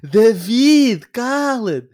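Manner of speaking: high, loud imitated shout of cala-te; mock yell. S1 imitating David's family telling him off: loud mock yell. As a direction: snap at David to shut up, loudly.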